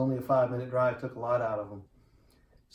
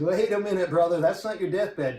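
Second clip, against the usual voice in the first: higher, louder insistent objection; correcting a friend who calls it his deathbed, louder and insistent; firm protest; 6 semitones higher, 6 dB louder than usual. Retold or imitated. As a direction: cut him off and insist.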